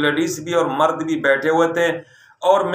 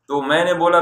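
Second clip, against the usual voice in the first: usual speaking voice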